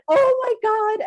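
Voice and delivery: high, loud retold "oh my God"; shocked gasp. Swimmer to herself, retelling her shock at the pool. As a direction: replay the moment the skirt slipped off.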